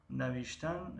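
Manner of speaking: ordinary talk, nothing marked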